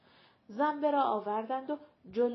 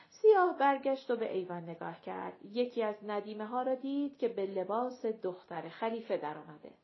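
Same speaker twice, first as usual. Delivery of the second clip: ordinary delivery, nothing marked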